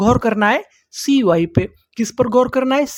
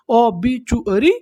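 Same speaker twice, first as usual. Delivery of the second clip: ओबीचुअरी drawn out slowly with a rising end; slow model reading; much slower than usual. Shown as a demonstration. Teacher repeats the word slowly for the class. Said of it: "English में क्या बोलते हैं".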